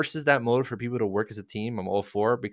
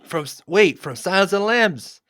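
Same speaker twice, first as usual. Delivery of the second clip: shocked; 10 semitones higher, 7 dB louder than usual. Pretend. Pretend discovery of Lecter cage.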